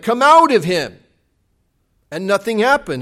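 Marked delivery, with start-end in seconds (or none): 0.0-1.0 a firm command to the demon, raised. Retold or imitated. Disciples command the demon, a first firm try. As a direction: order the demon out firmly. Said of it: "They say to this demon something like".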